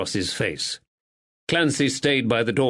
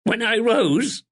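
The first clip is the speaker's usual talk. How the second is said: crackling with emotion; 11 semitones higher, 3 dB louder than usual. A narrator's reading. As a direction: fight back tears as you confess. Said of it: "Her voice crackled with emotion".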